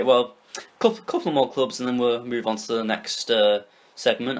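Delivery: ordinary talk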